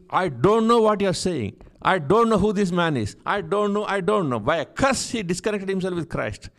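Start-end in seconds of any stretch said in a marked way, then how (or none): 0.1-4.4 higher, louder protest, lightly acted; emphatic acted disavowal; insistent denial. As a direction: deny it over and over, pressing harder. Acts Peter's repeated denial.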